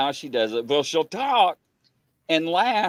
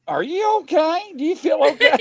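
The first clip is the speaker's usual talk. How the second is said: worried check-in; 13 semitones higher, 5 dB louder than usual. Retold or imitated. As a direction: ask anxiously, voice raised high. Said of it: "I'm going".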